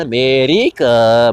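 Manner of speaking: loud, drawn-out Puerto Rican "America"; exaggerated Puerto Rican accent on "America"; mock Puerto Rican accent. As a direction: imitate West Side Story's Puerto Rican accent. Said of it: "West Side Story".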